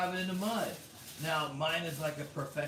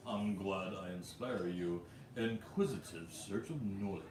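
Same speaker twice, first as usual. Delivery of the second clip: James Bond; 8 semitones lower, 5 dB quieter than usual. Acted. Streamer rereading a viewer's comment as James Bond, low attempted English accent. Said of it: "like that James Bond".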